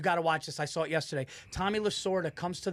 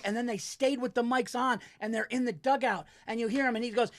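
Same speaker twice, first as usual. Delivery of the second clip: normal talking voice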